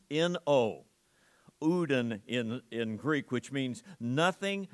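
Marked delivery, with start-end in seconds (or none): 0.1-0.8 each letter set apart; spelling out N O. Shown as a demonstration. Preacher spelling the word for the congregation, slow and firm. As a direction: spell it out for them. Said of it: "It's the word no".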